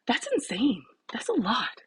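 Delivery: amazed whisper. Real, not acted. Surprised at follower count.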